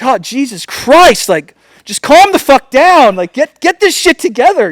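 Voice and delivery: officer ordering the jittery driver to settle down; imitating the cop. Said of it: "And the guy's like".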